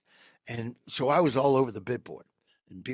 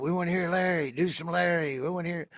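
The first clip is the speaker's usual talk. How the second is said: mild mock yell; 6 semitones higher, faster than usual. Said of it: "somebody yells out".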